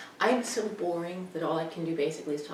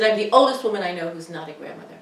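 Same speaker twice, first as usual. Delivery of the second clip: fast, louder mock indignant mother; mother's retort; 9 dB louder, faster than usual. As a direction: complain like an offended old mother. Mother's replies retold.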